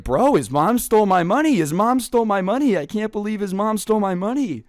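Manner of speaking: high, loud, incredulous repeating; wide-eyed disbelief. Retold or imitated. Friend telling the others, wide-eyed and high with disbelief. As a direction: hammer it home in disbelief. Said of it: "his eyes are huge".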